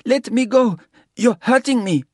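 high, loud frightened plea; whimpering plea. A narrator's reading. Woman struggling in his grip, frightened plea. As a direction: cry out to be let go. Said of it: "whimpered as she struggled".

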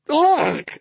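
very high "ugh" of revulsion; disgusted yelp. A narrator's reading. Dennis licked again by the dog, revolted yelp. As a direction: yelp while being licked all over. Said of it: "Dennis said again".

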